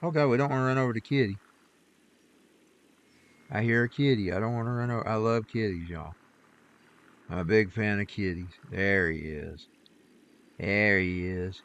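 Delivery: half to himself, watching the road for kittens; quiet aside. Real, not acted. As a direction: talk under your breath at the wheel. Driving, looking for a kitty.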